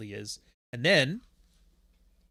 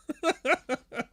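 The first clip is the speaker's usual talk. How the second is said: short, high burst of laughing; real laughter; 13 semitones higher than usual. Real, not acted. Laughs at own French.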